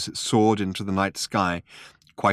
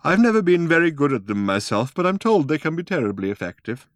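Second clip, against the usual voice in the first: wistful; 7 semitones higher, 3 dB louder than usual. Acted. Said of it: "another wistful look".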